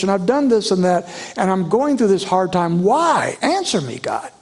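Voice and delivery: high, urgent, anguished questioning; David demanding answers. Acted. Preacher voicing David crying out why to God, high and anguished. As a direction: plead with God for an answer, voice lifted. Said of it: "He wants an answer".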